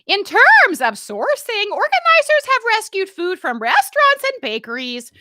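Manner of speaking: high, loud, slow announcer voice; broadcaster read. Shown as a demonstration. Loud hammed-up news read. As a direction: she reads her email like a broadcaster. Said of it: "You can read it like a broadcaster".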